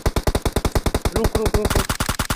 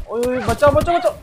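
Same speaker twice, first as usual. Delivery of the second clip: raging gamer; 5 semitones higher, 5 dB louder than usual. Real, not acted. Dying in game, calling for help.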